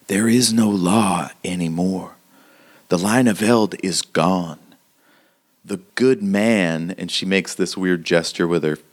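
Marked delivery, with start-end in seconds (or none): 0.1-6.9 grave frontier woman, measured and emphatic; hardened townswoman, solemn. Acted. Townswoman explaining the law is gone, solemn. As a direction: pronounce it like a hard-bitten local.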